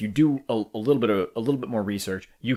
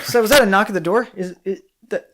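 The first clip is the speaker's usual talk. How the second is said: loud, much higher hammed-up radio line; mock stilted dialogue; 10 semitones higher, 8 dB louder than usual. Parodying stilted podcast dialogue with a friend, hammed-up mock panic. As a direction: overplay a panicked fifties actor. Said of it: "it seems very stilted".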